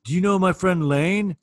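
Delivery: a lightly put-on eager voice, slow; a hint of a starstruck addict; the drummer asks a stranger, lightly voiced, slow; imitating the drummer. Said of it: "he's like".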